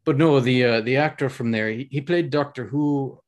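put-on, lively Scottish accent. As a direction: chat away in a practised Scottish accent.

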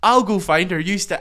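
bossy; mock bossy Brona. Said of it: "Brona is literally just".

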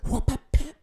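high, loud mock smacking noises; smack sound effects. Owner imitating the light spanks he gave the cat. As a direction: pop out the slaps like sound effects.

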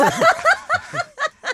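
high, loud burst of laughter; real high-pitched laughing. Real, not acted. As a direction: crack up before owning up. Laughs before admitting being used.

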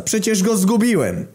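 very high-pitched enraged protest; furious shout. A narrator's reading. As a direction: yell it, utterly exasperated. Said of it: "krzyknął Lewandowski wkurwiony na maksa".

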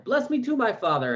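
a son's bitter plea, voice leaping up high; bitter cry. Acted. Esau begging his father for a blessing, high pleading cry. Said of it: "He cried an exceedingly great and bitter cry".

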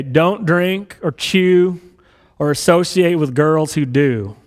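slow, stretched-out Texas preacher drawl. Retold or imitated. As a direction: drag each word out like a Texas preacher. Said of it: "I'll try to do my best Texas drawl here".

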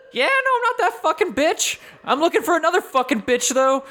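very high, screechy wraith voice; angry wraith. Acted. Wraith snaps at the party, angry high screech. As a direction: screech it like a foul-mouthed spirit. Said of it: "It is an angry wraith".

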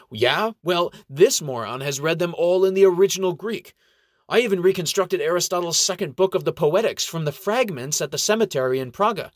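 a hint of a prickly little monk; feisty little gnome. A narrator's reading. Umbert boasting.

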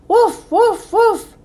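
three high woofs in a row; insistent dog bark. A narrator's reading. Ebb urging Flo and Mom along, barking. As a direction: keep barking until they follow. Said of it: "barked Ebb until".

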